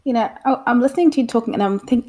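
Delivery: ordinary talk, nothing marked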